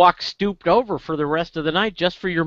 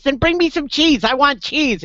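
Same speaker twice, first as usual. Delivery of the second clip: high-pitched, insistent little duck; demanding little duck; 9 semitones higher than usual. Acted. Duck insisting to the bartender, high demanding voice. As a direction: push it up high like a pestering duck. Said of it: "He goes".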